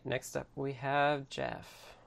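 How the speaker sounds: ordinary talk, nothing marked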